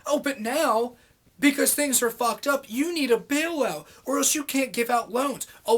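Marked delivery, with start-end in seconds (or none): none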